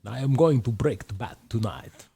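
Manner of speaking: slow put-on villain voice; Bane voice. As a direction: menace it slowly like Bane. Bane quote impression.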